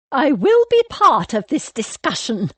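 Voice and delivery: put-on high, loud, commanding elderly lady; imperious old lady. A narrator's reading. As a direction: declare it like a grand dowager. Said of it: "she declared".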